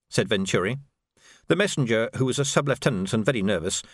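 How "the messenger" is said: ordinary delivery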